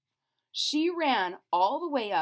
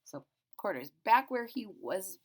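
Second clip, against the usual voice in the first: ordinary delivery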